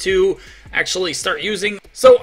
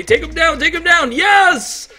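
excited cheering; 7 semitones higher than usual. Real, not acted. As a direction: cheer as the boss falls. Finally beating the boss.

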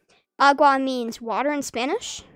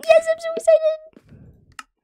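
much higher and louder, shrieking with joy; excited screaming; 9 dB louder, slower than usual. Real, not acted. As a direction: scream it out, thrilled to win. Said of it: "Sorry for screaming".

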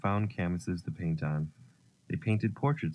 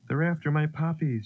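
high-pitched cry of alarm; storybook child's alarmed shout, moderately loud; 9 semitones higher, 4 dB louder than usual. A narrator's reading. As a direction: call out a warning, pitched high. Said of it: "shouted Katy".

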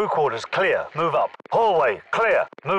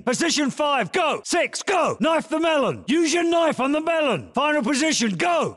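shouted commands; 13 semitones higher than usual. Acted. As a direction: yell the next position fast. Calling drill positions.

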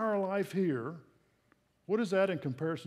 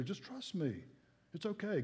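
softer, gentle reassurance; hushed comfort from God; soft divine whisper; 2 semitones higher, 7 dB quieter than usual. Acted. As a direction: murmur it like a quiet promise. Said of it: "God whispering in his ear".

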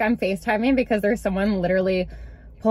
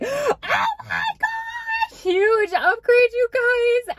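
drawn-out high squeal of amazement; hammed-up thrilled squeal; amazed; 13 semitones higher, much slower than usual. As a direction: gasp it out slowly, way up high. Acts out amazed reaction to working phone.

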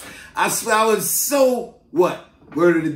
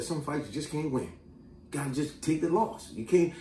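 much softer, lower, resigned counsel; uncle's advice; 4 semitones lower, 11 dB quieter than usual. Retold or imitated. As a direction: pass on an uncle's lesson quietly. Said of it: "My uncle said".

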